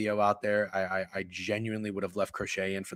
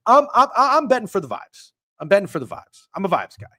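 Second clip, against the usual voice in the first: excited; 8 semitones higher, 10 dB louder than usual. Real, not acted. Excited rant about betting on vibes.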